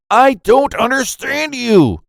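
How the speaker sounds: put-on high-pitched childish outcry; kid's yell. A narrator's reading. Lost child to the boy helping, high yell. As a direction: wail it out high like a frustrated kid. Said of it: "The kid straight up yelled".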